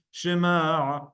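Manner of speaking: "Shema" drawn out, closing on a throaty ayin; "Shema" held long with ayin from the throat; ayin demo. Shown as a demonstration. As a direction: stretch the end of "Shema" into the ayin. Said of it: "Heard the Ayin?".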